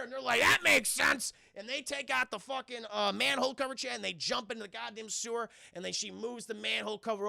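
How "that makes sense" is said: loud, high mock-earnest reply; hammed-up group agreement; shouted agreement. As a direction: yell back like eager kids. Boys answer Meg eagerly.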